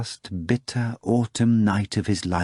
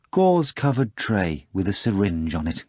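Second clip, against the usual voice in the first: usual speaking voice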